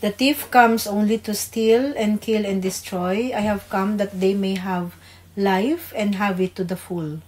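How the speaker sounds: ordinary delivery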